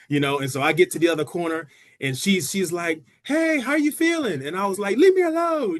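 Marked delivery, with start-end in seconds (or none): 3.2-4.4 high, slow, drawn-out taunt; teasing check-in. Retold or imitated. Sister to struggling sibling mid-race, teasing. 5.0-5.8 high, loud retold yell; exasperated yell. Tired racer to taunting sister, yelling. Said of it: "just yelling at her".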